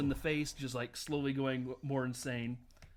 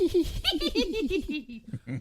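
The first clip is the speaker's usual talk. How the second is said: high loud sneaky weasel laugh; 7 dB louder than usual. Retold or imitated. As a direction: cackle like a little weasel. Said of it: "what's it sound like".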